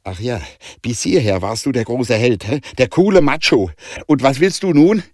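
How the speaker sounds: loud, high, scornful challenge turning insistent; angry outburst. A narrator's reading. Danica taunts Jonah and insists they will live, angry. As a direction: build to a loud, defiant demand. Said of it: "zischte Danica und schaute Jonah bittend an".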